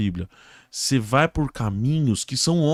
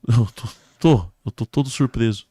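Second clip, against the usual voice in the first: victim answering. Acted. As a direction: stumble into repeating it back. Victim echoes the question.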